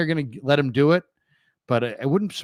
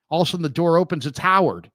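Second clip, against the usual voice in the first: ordinary delivery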